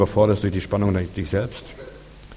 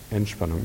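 ENTSPANNUNG softly released; relaxation; 5 dB quieter than usual. Shown as a demonstration. Preacher shows congregation release after tension, ENTSPANNUNG soft and relaxed. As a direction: let ENTSPANNUNG drop away softly. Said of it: "Merkt ihr das?".

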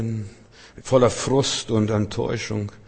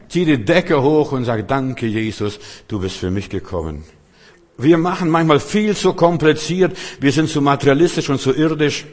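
loud, ringing sermon voice; fiery preaching; 5 semitones higher, 7 dB louder than usual. Acted. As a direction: preach it out to the whole hall. Preaching at full force.

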